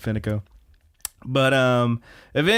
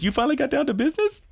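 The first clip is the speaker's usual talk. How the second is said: high, quick teasing voice; mock-scandalized high squeal; teasing question; faster than usual. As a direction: pitch it way up, prying and amused. Retelling friend's teasing question.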